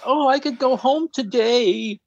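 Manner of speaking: high, drawn-out mock wail; mock crying out. Teasing next episode as Allison on the beach, mock crying. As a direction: cry it out slowly, high-pitched, imitating tears. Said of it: "crying and cries out".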